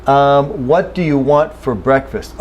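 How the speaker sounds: normal talking voice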